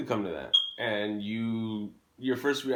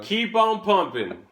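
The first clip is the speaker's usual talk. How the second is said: louder, higher chanted slogan; proud loud motto; 8 semitones higher, 7 dB louder than usual. Pretend. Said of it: "Say it loud".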